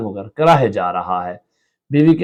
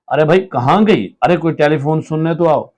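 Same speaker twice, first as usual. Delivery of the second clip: raised call; 5 semitones higher, 3 dB louder than usual. Acted. Husband calling the wife to answer the phone, loud. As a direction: call through the house.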